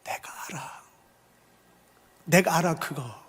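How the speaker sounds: soft whispered comfort. Acted. Jesus comforting the suffering, gently whispered. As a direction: hush it like a secret consolation. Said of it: "이 말이 위로가 돼요".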